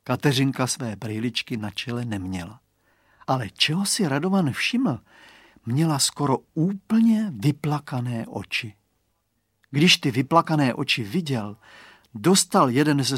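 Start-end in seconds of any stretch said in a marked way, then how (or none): none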